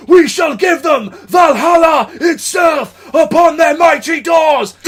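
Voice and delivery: high, loud, slow epic war-leader shout; massive speech. Retold or imitated. Leading festival crowd to the VIP area, epic shout. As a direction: deliver it like a battle speech. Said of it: "I'm making a massive speech like".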